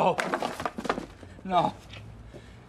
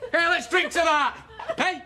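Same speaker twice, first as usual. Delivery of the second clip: bitter mock toast; 9 semitones higher than usual.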